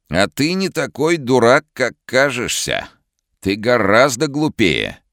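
high, slow, sinister booming voice; sinister other voice. Acted. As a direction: let a menacing stranger speak through him. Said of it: "каким-то другим зловещим голосом заговорил старик".

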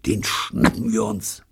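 slow conspiratorial whisper. A narrator's reading. Michael plots a capture with his friend, slow whisper. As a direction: whisper the plan slowly. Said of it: "flüsterte Michael".

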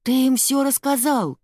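high-pitched, alarmed outburst; blurted accusation. A narrator's reading. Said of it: "выпалил Генри". (выпалить с упрёком)

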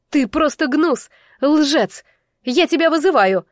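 high, loud accusation; angry challenge. A narrator's reading. Woman challenges the lying bull, angry. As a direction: throw down the challenge forcefully.

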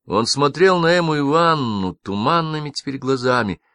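ordinary delivery, nothing marked

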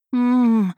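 a muffled begging hum; pleading muffled moan. A narrator's reading. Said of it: "взмолилась я".

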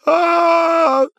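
long, very high panicked scream; thin drawn-out wail; high held scream of terror. A narrator's reading. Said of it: "вопил Квадриго уже где-то вдалеке".

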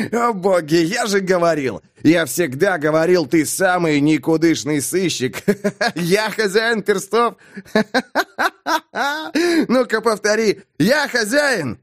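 high-pitched jeering through laughs; gleeful, laugh-broken ridicule; helpless mocking laughter. A narrator's reading. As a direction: taunt him between bursts of cackling. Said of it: "Бэкфилд согнулся от хохота".

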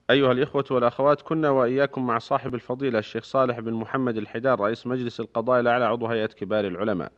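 formal radio announcer read; crisp, measured sign-off announcement; formal standard arabic. Acted. Radio host's closing announcement.

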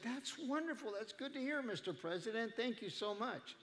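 high, polite gushing without raising volume; mock oblivious pleasantry. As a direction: imitate a guest cheerfully not listening. Oblivious guest answers terrible news cheerfully.

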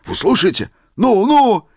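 eager urging. A narrator's reading. Old man urging everyone to listen, excited. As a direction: hurry them on.

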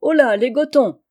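sudden call across the courtyard, high and loud; sudden shout. A narrator's reading. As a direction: bellow for the women all at once. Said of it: "s'écria-t-il tout à coup".

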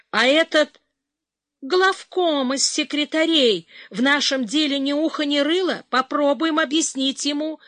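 rally with new spirit; perking up. A narrator's reading. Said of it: "Дежурный воспрял".

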